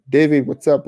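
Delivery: ordinary talk, nothing marked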